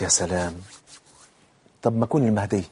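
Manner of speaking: low, soft musing to himself; understated, hushed wonder; self-satisfied musing. Acted. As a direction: voice a daydreamer quietly deciding something grand. Deluded man musing at home.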